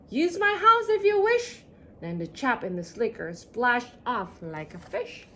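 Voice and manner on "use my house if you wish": calling out. Acted. Chap calls out while leaving.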